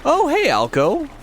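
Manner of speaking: high, bright-eyed hello to a returning viewer; excited greeting. Real, not acted. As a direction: squeal of welcome.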